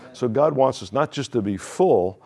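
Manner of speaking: usual speaking voice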